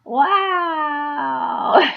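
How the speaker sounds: high, loud awed wow; a burst of remembered wonder; retold amazement. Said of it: "is like".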